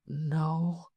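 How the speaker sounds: soft, high woman's murmur; half whispered. Acted. Woman stroking her lover's hand, half whispering. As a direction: answer softly, high and small. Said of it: "she half whispered".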